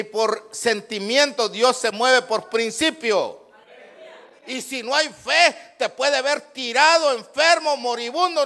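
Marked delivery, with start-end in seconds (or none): none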